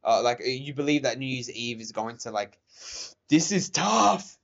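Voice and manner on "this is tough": slow, strained and louder; nervous at the wobbling tower; tense. Real, not acted.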